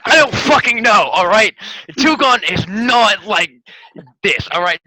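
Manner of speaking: loud, exasperated yell at the table; yelling in frustration. Real, not acted. Player yelling at fellow player in frustration. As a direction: shout it out, fed up. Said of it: "I'm yelling at this point".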